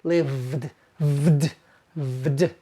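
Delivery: lived with buzzing vd; lived, low buzzing vd; vd ending. Shown as a demonstration. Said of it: "pronounced lived".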